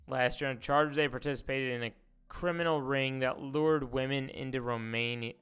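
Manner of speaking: normal talking voice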